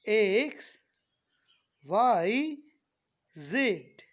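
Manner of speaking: एक्स, वाई, जेड loud with long vowels; stretched letter names. Shown as a demonstration. Teacher finishing the alphabet for students, loud and drawn out. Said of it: "थोड़ा खींच के".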